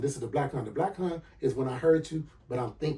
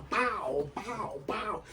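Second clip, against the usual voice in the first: mimicked blows, drawn out; punch sound effects; 7 semitones higher, 5 dB quieter, much slower than usual. Teller likens her answers to gut punches, imitated impacts. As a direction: voice each punch as it lands.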